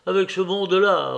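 high, loud protest; despairing steward pleading his case; exasperated, helpless steward. A narrator's reading. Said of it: "répondit l'intendant en faisant un geste désespéré".

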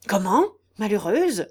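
indignant outcry. Acted. Aunt scolding her disobedient niece, indignant. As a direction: cry out in outrage. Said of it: "s'écria la gotte en élevant la voix".

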